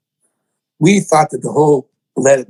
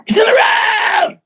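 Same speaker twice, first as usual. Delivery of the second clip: ecstatic scream; slower than usual. Retold or imitated. Stephen hearing the verse, screaming with joy. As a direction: shriek it with joy. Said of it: "and he went".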